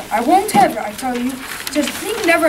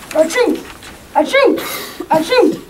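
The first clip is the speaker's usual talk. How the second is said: big sneezes; 5 semitones higher than usual. Acted. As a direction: sneeze hard three times in a row. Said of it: "you're sneezing again".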